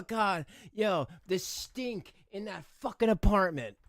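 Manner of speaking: ordinary talk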